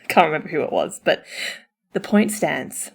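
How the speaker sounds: usual speaking voice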